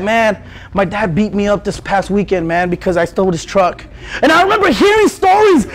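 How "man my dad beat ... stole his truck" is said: a friend's voice, low and soft; low hushed friend. Retold or imitated. Friend telling the circle his dad beat him, low and soft. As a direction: mutter it like a kid confessing.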